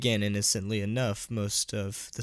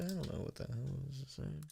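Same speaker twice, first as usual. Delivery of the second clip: ordinary talk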